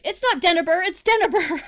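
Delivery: high, loud mock protest; little cousin. Young cousin correcting the teller on her name, high indignant imitation. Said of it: "and she was like".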